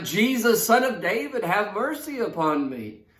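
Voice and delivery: crying out. Retold or imitated. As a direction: call out over the crowd, pleading. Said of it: "he's crying out".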